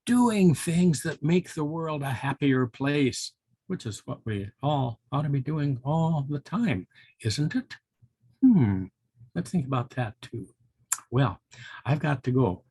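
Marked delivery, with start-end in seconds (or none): none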